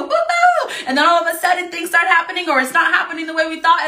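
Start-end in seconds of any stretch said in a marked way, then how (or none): 0.0-0.7 very high, loud "woo-hoo"; mock joyful cheer; acted whoop. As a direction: whoop as if setting off on a thrill ride. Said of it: "we start trusting, and we go".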